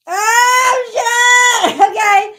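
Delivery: sudden loud, high yelp of surprise; startled shout. Real, not acted. Reader startled mid-reading, yelping loudly. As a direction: cry out as something goes wrong. Reacting to a dropped card.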